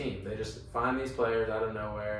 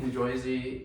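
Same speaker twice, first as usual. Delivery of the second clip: mock New Jersey accent, Jersey as "Joisey"; put-on New Jersey vowel; 3 dB quieter, slower than usual. Shown as a demonstration. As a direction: say Jersey as JOY-zee, New Jersey style.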